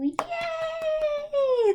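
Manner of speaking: high excited yay; big happy yay; cheer. Acted. Cheer after the song.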